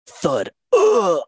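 sudden loud pained groan, high; groan of a fall. Retold or imitated. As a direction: let out a yelp as you hit the ground. Hammock falls, friend groans.

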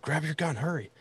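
high, hushed urgent call; mock urgent hunting-partner hiss to grab the gun. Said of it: "And he's like".

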